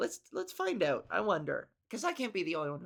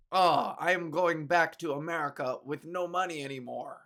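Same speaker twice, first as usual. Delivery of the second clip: louder, stilted mock-tragic line; wooden VIP lament; 3 semitones lower, 5 dB louder than usual. VIP going home broke, in a stiff hammed-up lament. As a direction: deliver it like a bad foreign-show actor. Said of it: "the VIPs are all like that".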